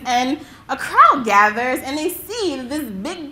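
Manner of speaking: ordinary talk, nothing marked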